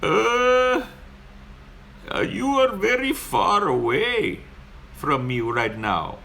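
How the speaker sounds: high, slow, hesitant mock Indian accent. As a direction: stretch it out like a puzzled driver in a put-on Indian accent. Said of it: "He's like".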